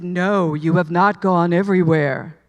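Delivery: a divine voice correcting her, low and resonant; deep voice of God effect. Acted. God answering her plea, in a deep booming voice. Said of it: "And he said".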